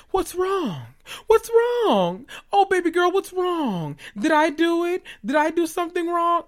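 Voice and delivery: high, sugary mock concern; mimicking Misha. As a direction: play Misha as sickly sweet innocence, pitched high. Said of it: "she's like".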